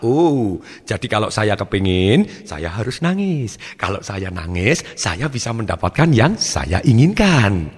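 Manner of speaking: slow, scheming inner thought; child working it out. Acted. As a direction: draw it out like a dawning realisation. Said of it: "dia menghubungkan antara satu perasaan".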